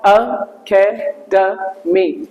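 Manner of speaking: four separate slow beats on "अकेडमी"; syllable by syllable. Shown as a demonstration. Teacher showing the chin-tap shortcut, "अकेडमी" in four slow separated syllables. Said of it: "जितनी बार आपकी जो ना चिन्ह आपके हाथ से touch हो".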